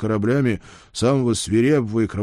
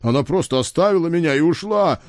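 helpless babble; 8 semitones higher, 4 dB louder, faster than usual. Acted. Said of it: "беспомощно лепетал я".

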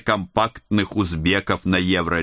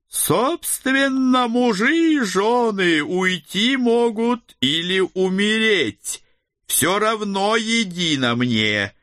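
eerie sorcerer voice; 11 semitones higher, slower than usual. A narrator's reading. Hooded figure speaks archaic menace.

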